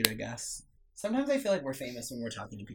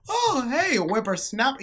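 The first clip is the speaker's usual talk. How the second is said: put-on much higher, chipper old-man voice; imitating cheery grandpa; 11 semitones higher, 5 dB louder, slower than usual. Granddaughter mimicking grandpa's chipper greeting. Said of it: "he went".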